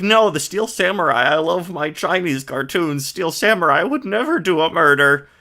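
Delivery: lightly voiced fangirl gushing; imitating Maya. Streamer mocking Maya's fandom, a hint of girlish gush. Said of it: "Maya was like".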